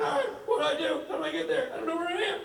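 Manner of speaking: high, lost and helpless mock whine; panicked Rob. Lost friend calling Rich, high helpless whine. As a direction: pitch it up like a lost drunk friend.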